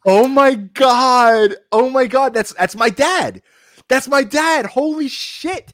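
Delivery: drawn-out, high-pitched exclamations; real astonishment. Real, not acted. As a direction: cry out amazed, pitch soaring. Stunned reaction to a new sign.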